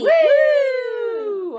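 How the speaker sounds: loud high cheer. Real, not acted. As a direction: cheer like at a party. Cheering segment start.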